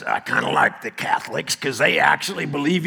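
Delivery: lightly voiced old cowboy; Brent. Cowboy friend on why he likes Catholics, light cowboy impression. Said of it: "He talks like this".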